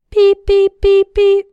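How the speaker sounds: high loud electronic beeps; imitated alarm beep. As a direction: beep like a metal detector. Metal detector alarm imitated.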